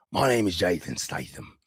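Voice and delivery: put-on a lightly done UK accent, a little raspy with attitude; a modest attempt at a British tough-guy voice with attitude; a hint of a London accent, raspy and cocky; Jason Statham impression. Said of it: "do an impression of Jason Statham".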